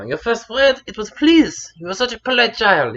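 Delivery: emphatic, high-pitched retelling; mother recalling fondly. Retold or imitated. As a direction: play a mum proudly telling an old story. Mother retelling childhood story.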